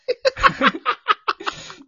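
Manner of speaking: high burst of laughter; real high-pitched laugh; loud laugh. Real, not acted. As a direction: crack up loudly. Laughing at own bit.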